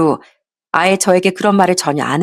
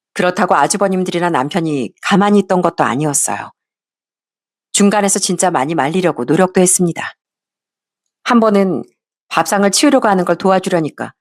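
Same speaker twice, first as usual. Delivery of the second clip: ordinary delivery, nothing marked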